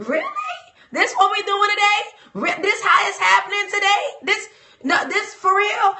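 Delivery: high, incredulous put-on voice; mock disbelief. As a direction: act stunned, as if nobody could believe it. Mock disbelief at work change.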